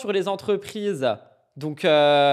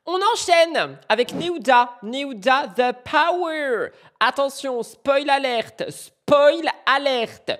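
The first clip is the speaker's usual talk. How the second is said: high, drawn-out show-host announcement; spoiler-alert fanfare in a high voice; hyped announcer; 7 semitones higher, 3 dB louder, slower than usual. Pretend. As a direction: announce it big, stretching the key words. Hyped presenter announces next topic.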